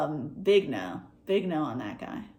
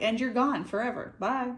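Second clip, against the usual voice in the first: mock catty dismissal, raised pitch; louder, higher catty send-off; 6 semitones higher, 4 dB louder than usual. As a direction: dismiss them with playful cattiness. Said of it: "the cattiness of like".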